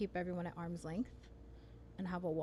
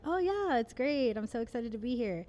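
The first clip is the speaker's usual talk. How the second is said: high, loud, put-on enthusiasm fading back down; forced brightness that slips; fake cheerful; 5 semitones higher, 6 dB louder than usual. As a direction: sell a fake happy front like a bad liar. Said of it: "I can do the whole".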